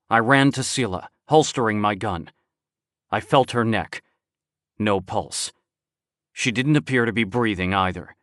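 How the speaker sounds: ordinary delivery, nothing marked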